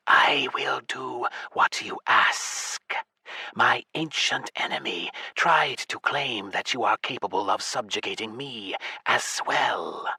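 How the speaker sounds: slow, hushed, whispery snake god; serpent whisper. Acted. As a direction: breathe it like an ancient serpent. Giant serpent god speaks telepathically.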